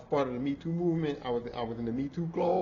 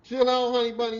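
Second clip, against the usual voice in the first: louder, higher chill-out warning; retold yell; 8 semitones higher, 8 dB louder than usual. To a coworker, a raised chill-out warning. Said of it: "That's how it was".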